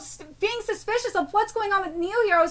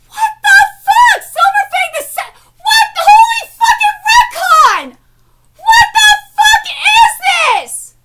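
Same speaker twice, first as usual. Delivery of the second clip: screaming disbelief; 14 semitones higher, 12 dB louder than usual. Real, not acted. Disbelief at manga reveal.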